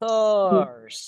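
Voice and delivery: "Thurs" loud, vowel like "e"; stressed syllable. Shown as a demonstration. Teacher isolating "Thurs" for the class, stressed. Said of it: "Itu ya. Bukan U".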